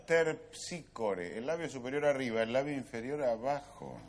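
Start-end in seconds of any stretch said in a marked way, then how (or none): 0.1-1.4 "Terpsícore" spelled out slowly, ter-psí-co-re, lingering on the end; teacher carefully pronouncing "Terpsícore"; slow careful pronunciation. Shown as a demonstration. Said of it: "Fíjese cómo lo pronuncio yo".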